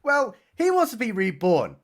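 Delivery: usual speaking voice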